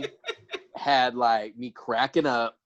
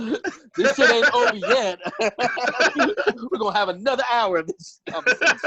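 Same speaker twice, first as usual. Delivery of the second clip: laughing; 11 semitones higher, 3 dB louder than usual. Real, not acted. Laughing at the long chat.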